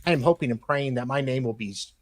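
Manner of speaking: ordinary talk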